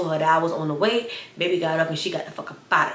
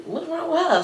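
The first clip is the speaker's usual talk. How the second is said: high puzzled question; 10 semitones higher than usual. Retold or imitated. Seatmate asking about her.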